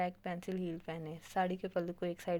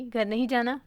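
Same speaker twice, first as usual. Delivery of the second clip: calls out louder to wake him; a raised call, half impatient; 4 semitones higher, 10 dB louder than usual. Acted. (ज़ोर से पुकारते हुए)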